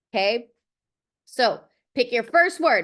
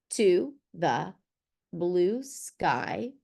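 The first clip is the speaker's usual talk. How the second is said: slow poem reading; 4 semitones lower, much slower than usual. Shown as a demonstration. Reads her example poem slowly.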